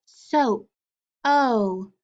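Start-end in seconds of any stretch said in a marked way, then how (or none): 1.2-1.9 "O" said alone as a long oh; isolated vowel sound. Shown as a demonstration. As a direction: stretch out "O" for the class. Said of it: "Digraph O A".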